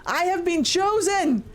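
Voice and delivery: hammed-up holy calling; inspired Andraste. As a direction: proclaim like a chosen prophet. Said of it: "And she's like".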